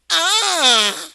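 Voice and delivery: loud exaggerated "ah" of alarm; mock panicked scream. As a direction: cry out in mock horror. Mimics men's automatic reaction.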